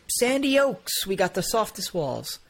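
high, sing-out welcome, drawn out at first; resort pitch. Acted. Asylum host inviting a patient in, high mock welcome.

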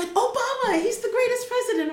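high, loud and fast praise; excited neighbor. Retold or imitated. A neighbor raving to the American traveler, excited. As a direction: gush like a fan. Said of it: "It's another neighbor".

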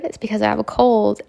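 ordinary talk

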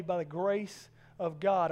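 ordinary talk

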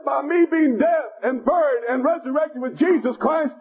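rhythmic, building revival sermon; impassioned preacher working up to a peak; fervent preaching. Real, not acted. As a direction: drive each phrase home to the congregation. Preaches to congregation.

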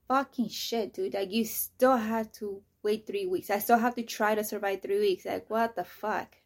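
loud, frustrated venting, dragging the words; exasperated outburst. Retold or imitated. Retelling the hospital's call, loud exasperated outburst. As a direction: blow up at having to wait. Reacting to three-week colonoscopy wait.

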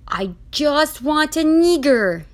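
put-on loud, high, drawn-out accented yell; high-pitched exasperated shout. A narrator's reading. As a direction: shout it slowly in frustration. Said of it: "he yelled".

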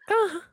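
brief high-pitched "ah"; mock a little high moan; imitated moan. Recalling sounds heard on the scrambled channel, mock high moan. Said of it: "you'll hear, like, a".

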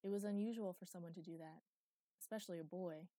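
plain reading voice

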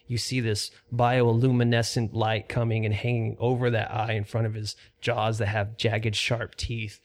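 steady, measured scene-setting; lightly dramatic dungeon description; game master narration. Acted. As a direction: paint the chamber for the players. GM sets the scene.

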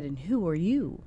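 lightly imitated film star's question; a hint of his voice, pitch swooping on "you"; Tom Hanks. Actress retelling how the star greeted her, light imitation. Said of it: "I could just hear that in his voice".

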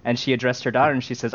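ordinary talk

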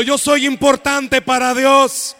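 "Yo soy importante para Dios" said out loud, full voice, like the congregation repeating it; loud declaration. Shown as a demonstration. Pastor leading the church to declare it aloud, loud and full. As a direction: proclaim it with the whole church. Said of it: "Pero dígalo".

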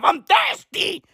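high, pleading cries, hammed up; mock desperate wail. Joking about ancient water, a hammed-up desperate wail. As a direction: beg for the water like a parched man. Comic desperate thirst bit.

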